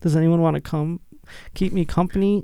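put-on high, whiny little-kid plea; mock pleading. Host begs friend to stay over, high mock whine. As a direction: beg for company in a put-on small voice. Joking plea not to be alone.

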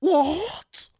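hammed-up British disbelief; terrible English accent. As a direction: exclaim it in a bad English accent. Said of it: "in a terrible English accent".

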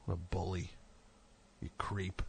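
soft unvoiced whisper; whispering an insult to the absent cop. Real, not acted. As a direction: hiss it under your breath.

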